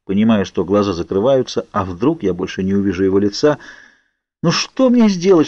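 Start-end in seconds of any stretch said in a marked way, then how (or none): 4.4-5.5 high-pitched, anguished question; panicked inner cry; desperate. A narrator's reading. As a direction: plead helplessly, pitch up. Narrator panics, losing consciousness.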